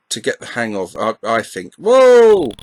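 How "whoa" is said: loud, high, drawn-out whoa; real startled yelp, pitched right up; startled exclamation. Real, not acted. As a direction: cry out in sudden surprise. Startled by the game.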